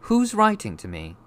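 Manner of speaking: high, loud question; insistent boy. A narrator's reading. Boy asking uncle who writes, high insistent demand. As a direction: press him for an answer.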